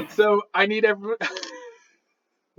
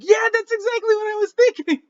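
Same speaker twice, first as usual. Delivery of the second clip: very high, eager squeal of agreement; retold excited agreement; 13 semitones higher than usual. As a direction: imitate a thrilled friend, much higher. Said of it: "And Allie was like".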